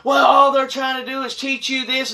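high, mocking nasty woman voice; high nagging church lady impression; mocking the hateful mom. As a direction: sneer it like the pushy church mom. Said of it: "Hateful, rude, nasty".

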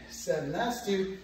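usual speaking voice

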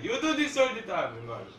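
annoyed yell. Real, not acted. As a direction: shout the complaint at your friend. Accusing friend mid-game.

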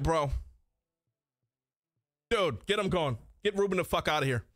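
usual speaking voice

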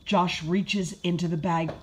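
ordinary delivery, nothing marked

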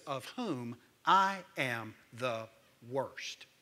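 low, soft, slow confession; solemn quote. Retold or imitated. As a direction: let the confession sink down. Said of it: "Paul says".

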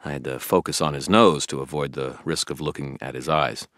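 ordinary delivery, nothing marked

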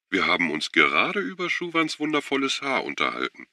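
high, sugary mock-friendly voice; a snooty woman teasing, voice pitched high; catty society lady. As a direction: purr it with fake sweetness, high up. Catty women mocking Schuwan.

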